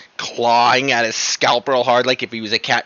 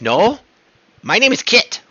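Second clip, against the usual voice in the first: a hint of a small imp, a little higher; lightly voiced imp; 8 semitones higher than usual. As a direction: squeak out the imp's protest, mildly. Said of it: "The imp says".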